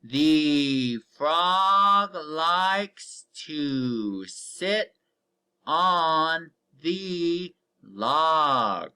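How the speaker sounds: very slow, word by word, each word drawn out; very slow read-aloud. Shown as a demonstration. Teacher reading a sentence to the class, very slowly. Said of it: "let's read from the top".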